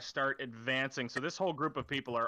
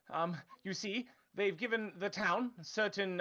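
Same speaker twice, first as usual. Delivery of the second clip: higher, hesitant official's voice; nervous town official; 5 semitones higher than usual. Acted. Cornered town official stammers excuses to the knife-wielding player, high and nervous. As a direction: fumble excuses like a cornered bureaucrat.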